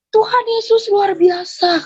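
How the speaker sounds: high, drawn-out exclamation; retold cry of amazement. Neighbour seeing her face, high imitated cry. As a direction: cry out slowly in imitated awe. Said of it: "dia langsung, dia teriak".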